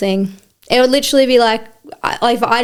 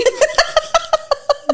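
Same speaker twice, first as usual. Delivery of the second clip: high squealing laugh. Real, not acted. Laughing at own bathroom story.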